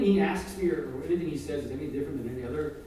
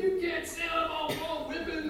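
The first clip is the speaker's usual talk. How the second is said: mock angry protest; 13 semitones higher than usual. Whitman man objecting to the caretaker's insult, angry. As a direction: protest way up high, mock outraged. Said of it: "it makes him really angry".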